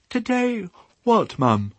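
high, slow sleepy piglet; high drowsy piglet voice. Acted. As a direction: ask it half awake. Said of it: "said Babe sleepily".

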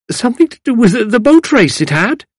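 put-on high-pitched old lady's question; hesitant old lady chiming in; old lady wondering. A narrator's reading.

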